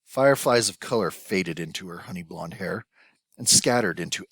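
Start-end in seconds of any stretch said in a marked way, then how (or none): none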